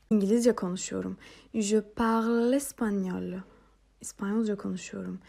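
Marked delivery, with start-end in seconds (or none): 1.5-3.4 slow, clear French phrase; slow model phrase. Shown as a demonstration. Teacher to students, slow model phrase. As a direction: say it slowly for learners to copy. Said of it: "yeni diller ekliyoruz".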